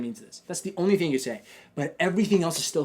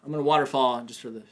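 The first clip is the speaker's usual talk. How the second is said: ordinary delivery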